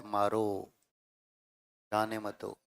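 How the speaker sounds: "मारो" alone, pause, then "जाने मत दो"; pause after मारो. Shown as a demonstration. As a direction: break clearly after "मारो", then finish "जाने मत दो" in one phrase. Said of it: "पहला वाक्य ये कहता है".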